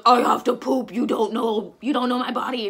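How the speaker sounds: insistent loud complaint at the nurses; retold protest.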